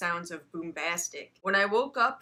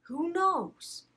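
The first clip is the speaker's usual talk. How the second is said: playful sign-off; 9 semitones higher, slower than usual. Acted. Outro sign-off.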